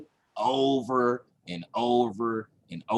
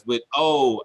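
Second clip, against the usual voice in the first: normal talking voice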